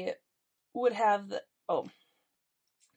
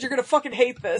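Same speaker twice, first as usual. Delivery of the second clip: fast, loud mock warning; loud teasing warning; 5 semitones higher, 10 dB louder, faster than usual. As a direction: warn loudly, half laughing. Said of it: "I said".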